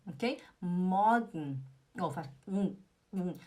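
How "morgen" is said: MORGEN as "MAW-gn", ending swallowed; slow "morgen" with no R; swallowed sound. Shown as a demonstration. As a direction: gulp down the end of morgen. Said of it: "Parece que você tá engolindo alguma coisa".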